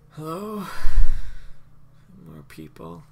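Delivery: high then hushed, squinting suspicion; woman at the door. Acted. Woman at the door squinting at the crowd, wary and hushed. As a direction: peer out warily at strangers.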